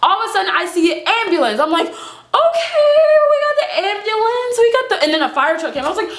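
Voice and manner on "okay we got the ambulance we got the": hammed-up high-pitched delight; mock excitement. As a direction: hail the help in a squeaky singsong. Acts relief at ambulance arriving.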